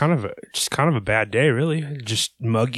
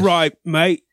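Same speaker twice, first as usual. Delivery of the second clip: high, loud Aussie accent attempt; hammed-up, botched Australian accent; punchy English-sounding take on an Australian accent; 6 semitones higher, 5 dB louder than usual. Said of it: "doing an Australian accent".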